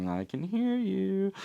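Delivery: high, soft and slow, eerie; creepy voice. Pretend. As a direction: whisper it like a creepy presence. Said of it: "which is creepy".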